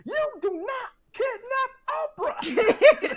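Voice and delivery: high shouted scolding, slowing down; scolding mom. Retold or imitated. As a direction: yell at the kid like an angry mom. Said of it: "something my mom would say".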